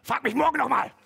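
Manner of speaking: loud, high outburst; exasperated mock yell. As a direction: blurt it loudly to fend off the question. Acts out dodging his proposal. (laut und hoch herausgeplatzt, gespielt)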